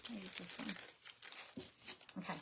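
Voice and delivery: ordinary delivery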